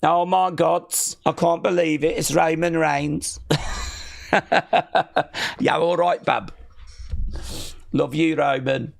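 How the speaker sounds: put-on excited Birmingham accent. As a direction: gush in a Brummie accent at the star. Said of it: "That's the Birmingham accent".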